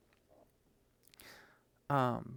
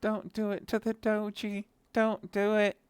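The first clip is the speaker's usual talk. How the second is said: high crying shout, much higher and louder; anguished crying out; 11 semitones higher, 5 dB louder, slower than usual. Retold or imitated. Reader begging the comic to spare the dog, crying out. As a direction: plead with the story in anguish. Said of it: "I was like".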